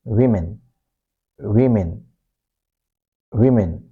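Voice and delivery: "women" as WIM-in with rounded lips on W. Shown as a demonstration. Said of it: "our lips are rounded".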